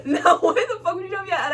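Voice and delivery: high-pitched incredulous retort through laughter; laughing indignation. Retold or imitated. Customer retelling cashier's insult, laughing indignation.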